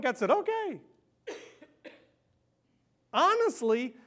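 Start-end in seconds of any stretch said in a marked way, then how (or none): 0.3-0.8 mock easy God. God voiced agreeing.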